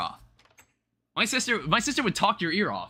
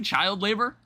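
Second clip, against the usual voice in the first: ordinary talk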